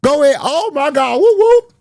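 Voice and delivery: mock hype with a whooping high finish; hammed-up hype girl. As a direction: ham it up like a fan hyping her model walk.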